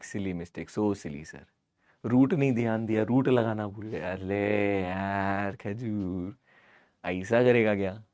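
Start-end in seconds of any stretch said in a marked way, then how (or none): none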